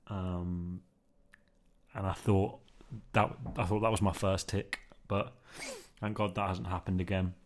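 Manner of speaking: ordinary delivery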